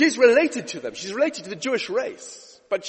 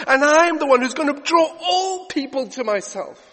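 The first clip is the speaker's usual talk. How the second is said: loud, higher preacher's voice; preaching at full force; 6 semitones higher, 6 dB louder than usual. Acted. Preacher proclaiming Jesus draws all people, full force. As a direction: call everyone in, rising.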